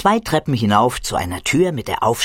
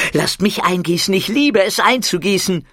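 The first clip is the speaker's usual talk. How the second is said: put-on eager kid; 7 semitones higher than usual. Muki voiced.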